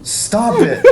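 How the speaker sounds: high, laughing protest. Real, not acted. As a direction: laugh it out as a plea. Laughing at the honking car.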